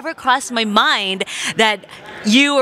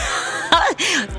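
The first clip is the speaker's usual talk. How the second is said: choked laugh on the verge of tears; teary laugh; 10 semitones higher than usual. Real, not acted. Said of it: "You made me tear".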